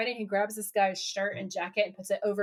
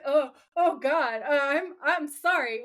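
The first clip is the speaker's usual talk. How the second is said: imitating a flustered, halting apology; embarrassed; 3 semitones higher, slower than usual. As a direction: stumble apologetically over the words. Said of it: "Sam is so embarrassed".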